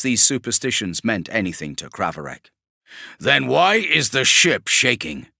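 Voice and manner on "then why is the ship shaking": slow, louder, menacing demand; menacing warsmith. Acted. Kravarek demands of the Magos, slow and menacing. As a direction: press him hard, word by word.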